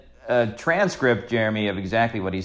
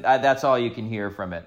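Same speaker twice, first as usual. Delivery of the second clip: usual speaking voice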